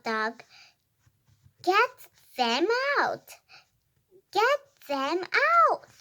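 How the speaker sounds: lively read-aloud with high animal calls; animated story reading. Acted. Reader voicing a quarrelling cat and dog, animated read-aloud. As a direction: read it out clearly, pitch jumping up on woofs and meows.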